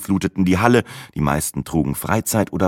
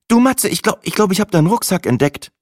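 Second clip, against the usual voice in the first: higher, breezy and unbothered; Sina, young woman; 12 semitones higher than usual. A narrator's reading. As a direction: Sina shrugging it off. Narrator voices Sina.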